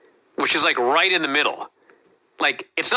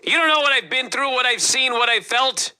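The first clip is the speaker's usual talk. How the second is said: raised, pleading Johnny quote; quoting Johnny; 8 semitones higher than usual. Retold or imitated. Johnny freaking out at the interviewer, higher and pleading. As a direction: voice Johnny's anguish a notch higher. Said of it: "and he says".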